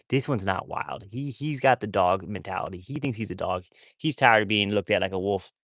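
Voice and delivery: ordinary delivery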